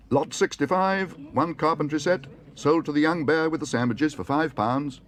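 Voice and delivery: high, loud auctioneer's announcement. A narrator's reading. Auctioneer selling a lot to the young bear, calling out. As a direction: announce the sale to a full hall.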